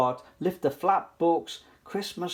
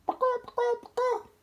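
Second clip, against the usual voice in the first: much higher clucking; chicken clucks; faster than usual. Acted. Three chickens fight over one sofa, high clucking. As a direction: cluck like a hen.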